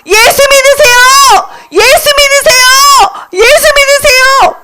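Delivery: high, repeated proclamation; insistent high call; evangelist's cry. Acted. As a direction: chant it high, over and over, to the crowd. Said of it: "계속 이렇게 말했어".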